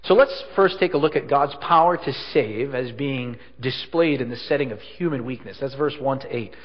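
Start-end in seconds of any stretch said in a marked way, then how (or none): none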